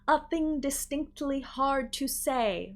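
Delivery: "distinctly" with every T crisp, slow theater warm-up; overpronounced for a warm-up. Shown as a demonstration. Teacher demonstrating theater warm-up overpronunciation. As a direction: spit out each T in "distinctly" like a stage drill. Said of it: "And we overdo all the words".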